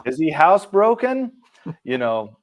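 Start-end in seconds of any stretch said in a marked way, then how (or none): 0.1-1.3 high, louder mock-parent question; wary parent questioning. Parents asking their child about the monster, wary. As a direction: act a parent's incredulous query, pitch climbing.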